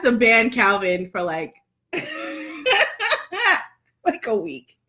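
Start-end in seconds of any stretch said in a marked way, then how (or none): 1.8-4.8 laughing. Real, not acted. Laughing fit mid-sentence.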